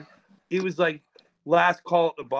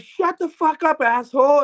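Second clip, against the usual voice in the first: much higher, louder mock yell; retold angry yell; 11 semitones higher, 6 dB louder than usual. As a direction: yell it back as in a told story. Acted yell at screaming cellmate.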